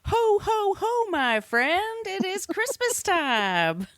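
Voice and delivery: high, slow jolly Santa ho-ho-ho; drawn-out, high Santa laugh; Santa Claus. Pretend.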